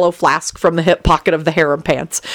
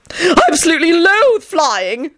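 higher, slow, shrill dread; screech; 12 semitones higher than usual. A narrator's reading. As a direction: screech in horror. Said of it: "Tony screeched".

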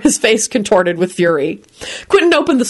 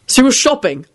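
higher, irritated snap; snapping angrily; 7 semitones higher than usual. A narrator's reading. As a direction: bite the question off. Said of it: "Quentin snapped angrily".

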